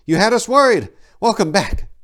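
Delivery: high, loud and relieved; glad greeting. A narrator's reading. Ameneth welcoming Tor back from the storm, joyful. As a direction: call out your relief he's home.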